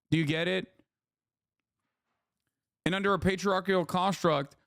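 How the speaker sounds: usual speaking voice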